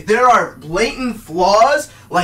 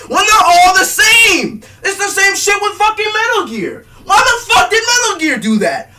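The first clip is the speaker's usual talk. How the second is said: high, loud yelling rant; furious yell; 9 semitones higher, 5 dB louder than usual. Real, not acted. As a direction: scream the frustration.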